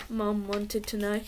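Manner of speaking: ordinary delivery, nothing marked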